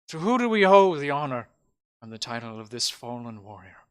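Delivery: lightly grand mayoral mourning; mayor honouring the fallen. Acted. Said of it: "and he goes".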